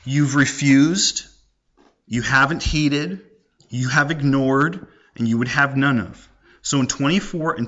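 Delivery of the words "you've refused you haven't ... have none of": slow, deliberate charges; measured, grieved accusation; slow accusing list. Retold or imitated. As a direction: lay out each refusal slowly. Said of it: "here she says".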